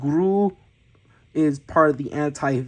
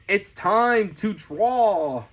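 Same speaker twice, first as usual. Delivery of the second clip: announcing a drawn caption; 4 semitones higher, much slower than usual. Acted. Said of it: "It says".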